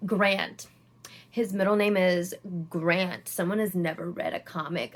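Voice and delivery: a hint of a nerdy know-it-all sneer; quiet, smug mockery of a nitpicker; mock condescending fanboy. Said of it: "fanboys that are like".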